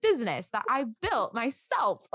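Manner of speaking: acted-out indignant pride; emphatic self-defence. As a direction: argue back at your own self-doubt. Acts out pep talk to self.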